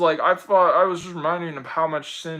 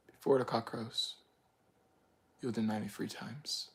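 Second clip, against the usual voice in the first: much softer, low solemn words; hushed quote; 5 semitones lower, 14 dB quieter than usual. Retold or imitated. Recalling Jesus' warning to Peter, hushed and low. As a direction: murmur it like a prophecy. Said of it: "what Jesus said".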